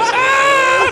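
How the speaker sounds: high shrieking scream of panic; panicked scream. Retold or imitated. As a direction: yell out in terror while driving. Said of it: "So I'm just like".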